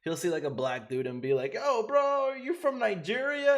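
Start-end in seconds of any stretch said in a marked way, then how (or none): 1.5-3.6 much higher, louder teasing impression of Daniel; mock Daniel. Streamer roasting a viewer on live, high mock voice. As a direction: ham up the streamer calling out a viewer.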